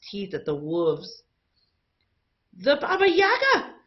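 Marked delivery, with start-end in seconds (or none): 2.5-3.7 very high, loud shriek of fear; terrified scream. A narrator's reading. Crowd spotting the monster, high terrified scream. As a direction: shriek the name in terror. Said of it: "the crowd screamed".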